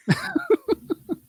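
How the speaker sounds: squeaky burst of laughter; high-pitched laugh. Real, not acted. Laughing at himself.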